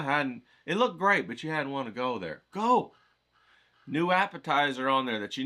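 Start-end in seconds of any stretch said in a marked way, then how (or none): 2.5-2.9 sudden high shout of go; excited shout. Real, not acted. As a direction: push them out the door with it. Urging viewers to go.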